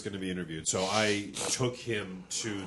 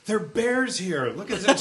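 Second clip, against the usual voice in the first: much higher and louder, slow, half laughing; excited; 9 semitones higher, 5 dB louder, slower than usual. Retold or imitated. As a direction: show off the discovery to a girlfriend. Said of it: "she turns to her girlfriend and goes".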